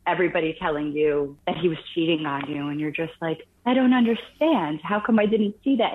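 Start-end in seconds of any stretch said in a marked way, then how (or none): 3.7-5.9 higher, baffled replaying of it; bewildered self-questioning. Jilted girlfriend replaying the breakup in her mind, bewildered. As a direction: question yourself in raised-pitch disbelief.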